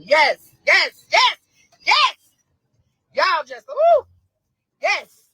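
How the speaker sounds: overjoyed shouts of yes; ecstatic yelling. Real, not acted. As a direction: scream yes in pure joy. Mother rejoicing at the verdict.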